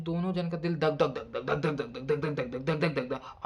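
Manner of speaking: rapid mouth-made dhak dhak heartbeat. Retold or imitated. Groom's nervous heart on the wedding night, mouth-made thumping. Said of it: "मैं मुंह से बोल रहा था".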